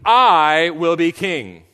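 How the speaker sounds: loud, drawn-out boast with a stretched I; self-exalting proclamation. Acted. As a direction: proclaim it slowly and grandly. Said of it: "exalted himself".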